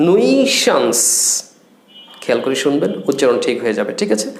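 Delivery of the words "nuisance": "nuisance" said alone, higher and fully clear; careful pronunciation. Shown as a demonstration. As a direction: lift "nuisance" out and pronounce it cleanly for learners. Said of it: "উচ্চারণটা ঠিক করবেন". ("nuisance" উঁচু স্বরে আলাদা করে স্পষ্ট উচ্চারণ)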